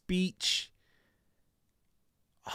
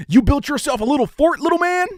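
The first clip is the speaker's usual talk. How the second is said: loud, higher, brash dubbed-movie voice; movie voiceover impression; 9 semitones higher, 6 dB louder than usual. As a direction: belt it like a TV-edit dub. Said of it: "You know the voiceovers".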